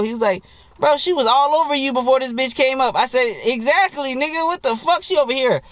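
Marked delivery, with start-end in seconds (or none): 0.8-2.9 incredulous friend. Retold or imitated. Retells laughing friend's disbelief. 3.4-5.6 mock incredulous agreement. Acted agreement with incredulous friend.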